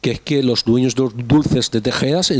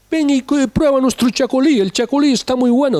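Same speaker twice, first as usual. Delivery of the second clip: put-on much higher, louder Galician-accented ad read; mock Galician ad voice; 13 semitones higher, 3 dB louder than usual. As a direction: ham up a Galician radio ad, pitched way up. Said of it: "un anuncio con acento gallego".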